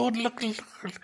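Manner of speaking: a touch of breathless Christmas-morning amazement; kid on Christmas morning. Acted. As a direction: lightly act out an excited kid on Christmas morning. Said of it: "kid on Christmas morning".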